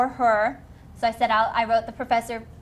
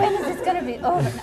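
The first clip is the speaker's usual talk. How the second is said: mock despair; 7 semitones higher, faster than usual. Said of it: "crying on the pavement going".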